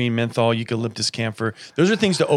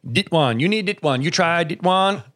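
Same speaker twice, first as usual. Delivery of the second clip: put-on Thai accent; 6 semitones higher than usual. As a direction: imitate the Thai shop owner's accent. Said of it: "He's like".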